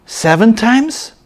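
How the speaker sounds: high, slow, doubtful question; Peter asking. Acted. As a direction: stretch it out as Peter in disbelief. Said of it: "Peter came and said to him".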